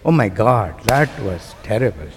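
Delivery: usual speaking voice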